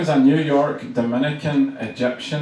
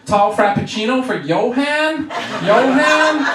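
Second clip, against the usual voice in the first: imitated barista hollering for a customer; barista calling out; 9 semitones higher than usual. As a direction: call the order across the shop. Barista calling out an order name.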